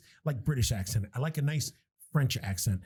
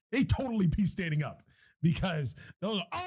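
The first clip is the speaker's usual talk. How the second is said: usual speaking voice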